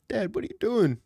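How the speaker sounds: asking like you can't believe it; startled son. Retold or imitated. Son confronting his dad in startled disbelief. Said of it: "And I said".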